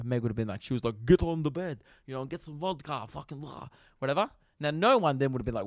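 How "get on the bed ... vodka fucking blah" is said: mock Russian accent, tossed off. Retelling a friend's story as if the woman were Russian, mock Russian accent. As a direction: put on a rough Russian voice. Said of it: "say that she was from Russia".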